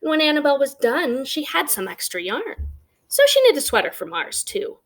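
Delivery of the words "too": plain reading voice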